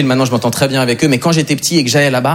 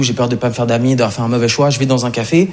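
ordinary talk

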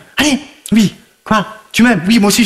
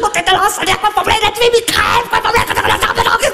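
cri furieux; 10 semitones higher than usual. Acted. Dispute jouée, cris.

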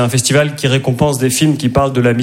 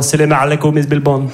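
Arabic-dubbed James Bond. Retold or imitated. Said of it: "voir James Bond en arabe".

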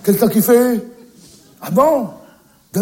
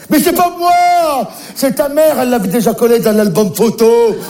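shouting the blame onto the mother down the phone; defensive shout; 4 semitones higher than usual. Acted. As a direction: yell back that it wasn't him.

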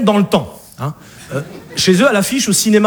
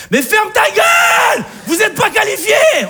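very high, drawn-out yell; comic outburst of exasperation; worked-up angry shout; 13 semitones higher than usual. Acted. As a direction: lose your temper and scream it. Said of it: "Oh putain, là je m'énerve".

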